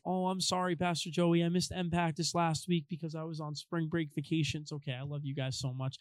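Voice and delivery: soft, lightly voiced girl apology; a hint of an apologetic girl. Youth group girl excusing herself to the pastor, lightly girlish. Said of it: "I always do a girl voice".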